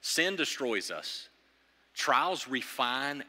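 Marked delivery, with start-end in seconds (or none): none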